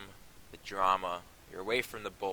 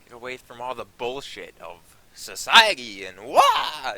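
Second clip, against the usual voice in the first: gleeful outburst; 3 semitones higher, 12 dB louder, slower than usual. Real, not acted. Vacation rant climax.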